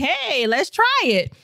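upbeat let's-do-it quote; eager self-quote. Retold or imitated. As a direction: toss it off like a dare to yourself. Quoting her own bold plan.

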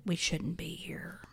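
whispered, retold warning; mock-ominous whisper; hushed warning. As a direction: drop to a whisper, quoting an ominous line. Quoting Deke's eerie warning to Webster.

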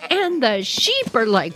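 ordinary delivery

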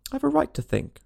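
sharp, high-pitched retort. A narrator's reading. Alice, getting worried, answers the Duchess back sharply. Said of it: "said Alice sharply".

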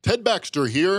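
hammy newsman voice; announcer voice. Pretend. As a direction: put on a cheesy anchorman. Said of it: "in your fake voice".

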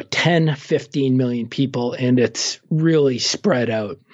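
ordinary delivery

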